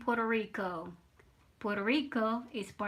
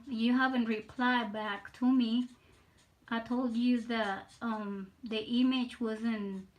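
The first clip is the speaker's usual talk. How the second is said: normal talking voice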